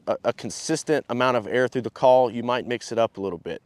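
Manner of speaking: ordinary talk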